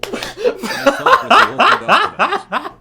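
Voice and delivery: high, loud laugh through words. Real, not acted. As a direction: crack up mid-sentence. Narrator cracks up laughing.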